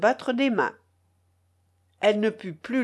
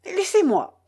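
panting retort; 10 semitones higher, 4 dB louder than usual. A narrator's reading. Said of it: "répliqua Stéphane Alexévitch tout haletant".

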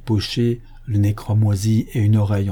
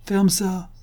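brusque, higher-pitched cut-in; 9 semitones higher than usual. A narrator's reading. Detective interrupting the suspect's protest, brusque. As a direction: cut him off curtly, pitched up. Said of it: "fit le premier détective brusquement".

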